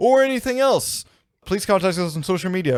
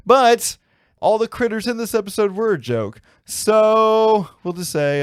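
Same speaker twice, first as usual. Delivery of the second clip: playful high voice; 8 semitones higher, 5 dB louder than usual. Acted. Hammed joke delivery.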